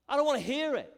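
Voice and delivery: high, loud, acted refusal; angry rebuke. God rebukes greedy exploiters of the poor, angry.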